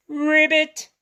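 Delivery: high, loud mock frog ribbit; frog croak. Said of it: "pretend to be a frog".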